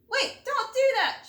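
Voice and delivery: high, loud warning shout. A narrator's reading. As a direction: yell to stop her. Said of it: "shouted a loud voice at me".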